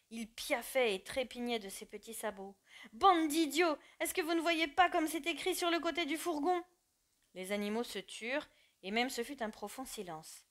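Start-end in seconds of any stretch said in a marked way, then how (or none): 2.9-6.6 high, loud furious bray. Acted. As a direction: bray the insult at the crowd. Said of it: "se mit à braire".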